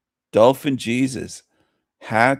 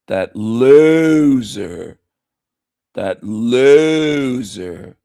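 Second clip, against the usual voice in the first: slow, drawn-out sneer, louder; mocking loser; 3 semitones higher, 6 dB louder, much slower than usual. Streamer taunting a rival, slow loud sneer. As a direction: stretch out loser with scorn.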